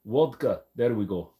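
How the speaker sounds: one-word mock Russian accent. Streamer retrying after failed attempt, mock Russian accent. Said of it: "I really can't do a Russian accent today".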